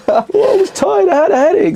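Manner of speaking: high, put-on whiny excuse voice; girlfriend's excuse. Girlfriend excusing her nap, high put-on whine.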